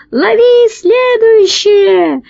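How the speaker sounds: very high, slow, drawn-out cry; thin-voiced shout. A narrator's reading. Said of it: "закричал тонким голосом толстяк". (протяжный крик тонким голосом)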